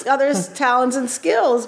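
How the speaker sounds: laughing through the words. Real, not acted. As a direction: talk while laughing. Laughing while giving director advice.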